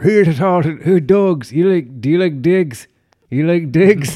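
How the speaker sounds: put-on loud, slow, barely intelligible Irish accent; Mickey from Snatch. Podcaster as a film character selling dogs, loud garbled Irish accent. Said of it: "with the inaudible kind of".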